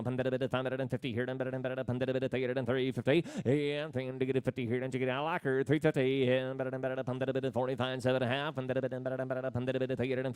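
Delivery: rapid rolling auction chant; auctioneer. Acted. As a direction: rattle off bids in a fast singsong. Livestock auction bid calling.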